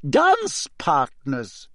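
high, very slow and cutting; cold, spelled out. Acted. Teacher spelling out dance partners to Harry, coldly slow. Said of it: "she said coldly".

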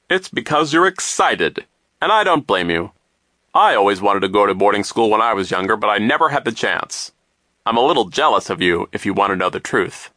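loud, self-assured grown-up voice; pompous, chummy adult; hearty banker figure. A narrator's reading. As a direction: boom it out to the children.

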